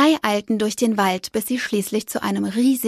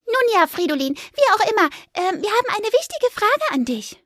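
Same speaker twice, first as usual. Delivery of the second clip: higher, eager little mouse voice; squeaky mouse Fipper; 11 semitones higher than usual. Acted. Fipper asks Fridolin for help, high squeaky mouse voice. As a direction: Fipper the mouse politely asking the squirrel a favour.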